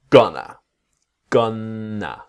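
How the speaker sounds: "gonna" said as "gunna", tongue on the top; demo of "gonna". Shown as a demonstration. Teacher modelling "gonna" for students, tongue tapping the top. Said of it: "the tongue touching here".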